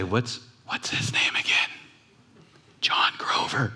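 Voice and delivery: hushed whispered aside. Retold or imitated. Wife whispering to husband mid-prayer, hushed. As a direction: whisper it so nobody hears.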